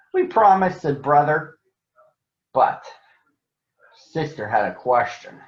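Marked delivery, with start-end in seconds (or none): 0.1-0.8 higher and louder, eager cubs; eager promise. Acted. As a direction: promise it like keen kids.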